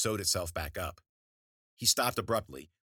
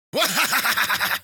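high, loud crone cackle; evil cackle; 11 dB louder than usual. A narrator's reading. Witch cackling after her threat to Wheeler, loud high cackle. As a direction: cackle with delight.

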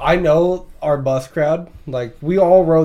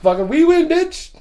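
gloating cheer; 9 semitones higher, much slower than usual. Retold or imitated. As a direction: crow the win over them. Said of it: "I'm like".

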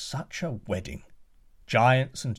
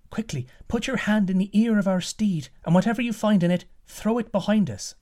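much higher, fast urgent voice; urgent daughter; 9 semitones higher, 4 dB louder than usual. Acted. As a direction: hurry him, high and breathless with urgency. Daughter urges the prince.

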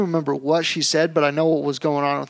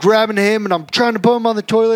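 ordinary delivery

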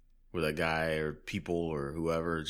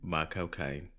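My cocaine in a light mock British accent; Michael Caine accent; slower than usual. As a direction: echo it like a bad Michael Caine. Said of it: "the best way to say Michael Caine in a Michael Caine accent".

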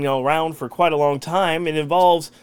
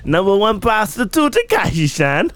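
higher mock Kazakh accent, Borat style; Borat impression; 6 semitones higher than usual. Host keeps the Borat bit going, higher, in a mock Kazakh accent. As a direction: deliver it like Borat bragging. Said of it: "A little bit Borat-y".

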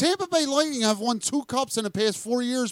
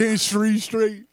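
mock drunk slur. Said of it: "signature slur".

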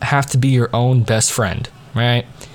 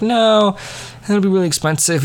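mom's objection, lightly imitated, higher; mildly acted, pitched-up refusal; retold protest; 8 semitones higher than usual. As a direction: open on a high protesting no. Voicing mom refusing the idea.

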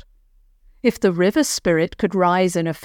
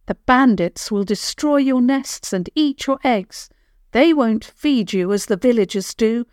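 calling out; 5 semitones higher than usual. Acted. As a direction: call the birds to come. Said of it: "He called out again".

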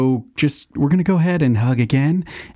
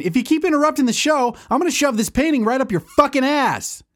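pitched-up furious threat; furious high rant. Acted. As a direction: screech a threat at him. Threatening Kevin.

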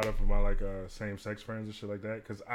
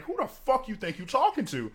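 higher-pitched, indignant comeback; retold snapping outburst; 10 semitones higher than usual. Bartender turning on the accusing woman, retold indignant outburst. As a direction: reenact losing your temper. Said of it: "So after I click, click out on it".

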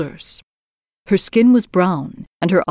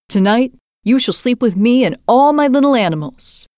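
put-on robber girl; 6 semitones higher than usual. Robber girl tells Gerda where she sleeps, higher bossy child. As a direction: announce it like a little queen. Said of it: "the robber girl said".

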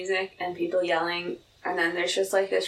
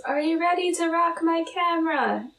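mock yell; 9 semitones higher than usual. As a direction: imitate a hyped-up photographer without real volume. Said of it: "and he yells".

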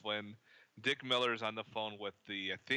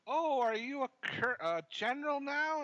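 high squeaky mock lady voice, much higher; big woman's voice. Retelling a movie scene to a co-host, high mock lady voice. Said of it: "this big woman comes up to him and goes".

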